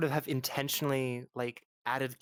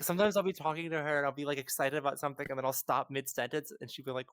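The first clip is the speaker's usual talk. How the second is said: normal talking voice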